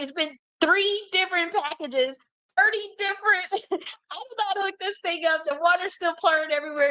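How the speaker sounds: high, strained, on the verge of crying; near tears. Retold or imitated. Retelling her overwhelm at the leaking sink, high and teary. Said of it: "I was, like, about to cry".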